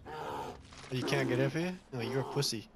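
usual speaking voice

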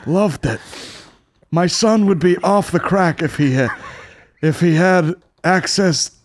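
slow, high hammy American speech; mock American president. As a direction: speak like a folksy old president.

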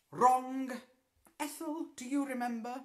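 high, loud, clipped-off correction; stern teacher. Acted. Miss Hardbroom correcting her pupil in a stern, high voice. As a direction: correct the girl like a strict witch mistress.

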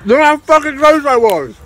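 loud, high frustrated yell; frustrated shout. Real, not acted. Just failed the chili challenge.

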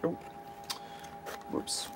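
ordinary talk, nothing marked

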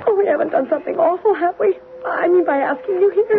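frightened. Acted. Frightened wife fears ghost.

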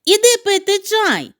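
high loud call. Acted. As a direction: invite him in for tea loudly. Hedgehog calls from porch.

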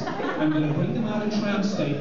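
usual speaking voice

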